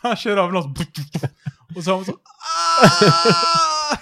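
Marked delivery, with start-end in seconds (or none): 2.1-4.0 high, loud drawn-out "aah"; hammed-up wail held long; mock cry. Driver's cry in tram story. (låtsasskrik, högt och utdraget)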